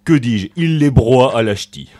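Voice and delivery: slow, hammed-up northern French Ch'ti accent. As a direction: drag the vowels out in mock Pas-de-Calais accent. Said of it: "notre magnifique accent du Nord".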